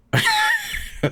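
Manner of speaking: shrieking burst of laughter; high laugh. Real, not acted. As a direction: crack up high. Laughs at 2am stream.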